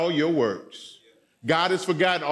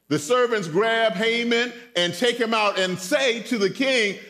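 usual speaking voice

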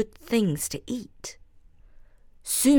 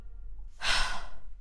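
soft, breathy sigh; resigned sigh; 7 dB quieter than usual. A narrator's reading. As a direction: give in to fate.

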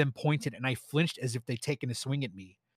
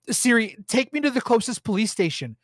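high, tearful pleading to the phone; panicked sobbing request to Siri; crying plea; 9 semitones higher, 5 dB louder than usual. A narrator's reading. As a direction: choke the words out through tears. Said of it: "I was crying so hard Siri couldn't understand me".

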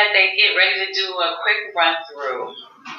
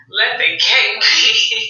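mock preachy prayer; 9 semitones higher, slower than usual. Tamar's over-the-top prayer.

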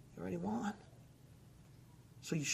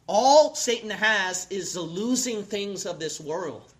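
loud, fiery sermon, louder and higher; preaching at full force; 4 semitones higher, 10 dB louder than usual. Acted. Preacher warning the congregation about Satan, shouted. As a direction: pound it out from the pulpit.

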